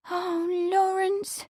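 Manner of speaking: long, high, swooning sigh. A narrator's reading. Amelia melts at Lawrence's declaration, sighing. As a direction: draw it out slowly, high and lovestruck. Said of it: "Amelia sighed".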